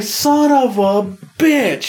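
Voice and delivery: very slow, high, drawn-out son of a bitch; high, slow, groaning curse; dragged-out curse. Retold or imitated. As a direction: stretch the curse out in disbelief. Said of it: "I was like".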